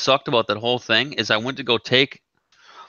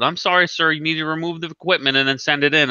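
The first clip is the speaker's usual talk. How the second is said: higher-pitched mock support agent; lightly mocked customer service; unhelpful company rep; 6 semitones higher than usual. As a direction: imitate a rep reciting policy, pitched up. Mimics rep refusing to help.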